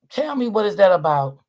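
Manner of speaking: normal talking voice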